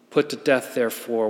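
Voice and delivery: normal talking voice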